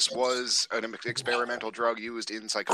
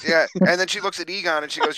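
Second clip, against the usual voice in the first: excited; 6 semitones higher, 6 dB louder, faster than usual. Real, not acted. Excited retelling, fast and loud.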